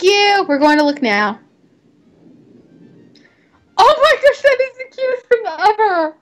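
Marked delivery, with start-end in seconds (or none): 3.8-6.1 high squeal of delight; gushing. Real, not acted. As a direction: squeal over something adorable. Gushing over cute horse photo.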